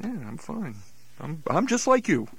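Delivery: usual speaking voice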